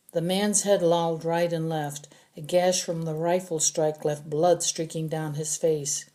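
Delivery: ordinary delivery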